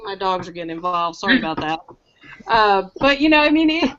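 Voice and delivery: usual speaking voice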